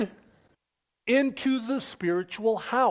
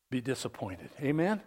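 ordinary talk, nothing marked

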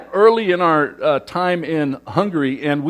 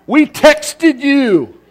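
mock whiny kids, higher, louder and slowing at the end; exasperated kids; 8 semitones higher, 6 dB louder, much slower than usual. Kids correcting dad about his texts, mock exasperated. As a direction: imitate the kids rolling their eyes. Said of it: "And my kids go".

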